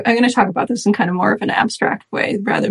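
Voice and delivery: ordinary talk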